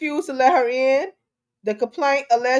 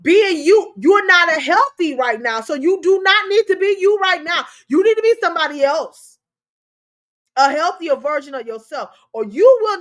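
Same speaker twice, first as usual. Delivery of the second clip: higher and louder, driving each point; forceful preaching; 6 semitones higher, 5 dB louder than usual. Real, not acted. Host lecturing viewers about their health, forceful. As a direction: preach it hard at the audience.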